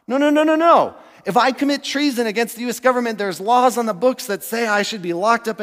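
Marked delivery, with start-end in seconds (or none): 0.1-1.0 high, insistent string of noes; preacher emphatically rejecting the idea; emphatic refusal. Real, not acted. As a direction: shut it down firmly.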